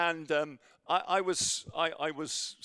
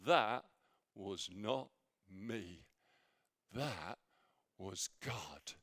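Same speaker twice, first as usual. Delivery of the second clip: much softer, slow, hushed words with pauses; soft inner voice; 7 semitones lower, 6 dB quieter, slower than usual. Retold or imitated. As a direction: let it fall to a reverent hush. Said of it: "in my heart went like this".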